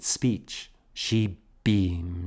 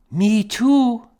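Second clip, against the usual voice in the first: put-on very high, louder little girl; eager little girl; child voice; 14 semitones higher, 7 dB louder than usual. A narrator's reading. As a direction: chirp it like a small child.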